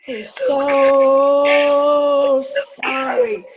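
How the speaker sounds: slow, breaking with emotion; a real catch in the voice on sorry; tearful, drawn out. Real, not acted. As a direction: let the words stretch out as tears come. Medium overcome relaying apology.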